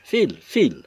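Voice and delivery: loud, high repeated order; enraged. A narrator's reading. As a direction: drive him off in a rage. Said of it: "continuant toujours avec rage". (ordre furieux et répété)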